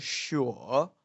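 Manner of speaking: bend "sure" down and up like a question; changing tone. Shown as a demonstration. Teacher shows how he speaks the word, dipping tone. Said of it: "how he speak this word".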